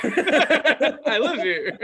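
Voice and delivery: very slow, high, drawn-out stoned voice; dazed stoner. Retold or imitated. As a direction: stretch it out like a fried teenager. Stoned reply to mom.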